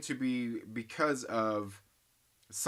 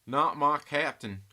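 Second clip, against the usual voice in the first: put-on slow, drawn-out Southern accent, a bit louder; 2 semitones higher, 5 dB louder, slower than usual. Playing the comment for chat, in a slow Southern accent. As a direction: stretch it out in a thick Southern accent. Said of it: "in Southern accent".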